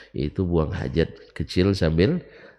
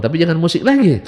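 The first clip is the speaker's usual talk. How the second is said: emphatic warning; 9 semitones higher, 9 dB louder, faster than usual. Real, not acted.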